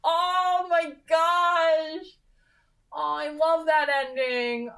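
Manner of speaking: thrilled singsong. Real, not acted. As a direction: sing it out, overjoyed at the ending. Reaction after finishing the book.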